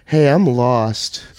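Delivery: very slow, dazed; slow, woozy admission; lost and sad. Retold or imitated. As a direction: drag it out helplessly. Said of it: "I go".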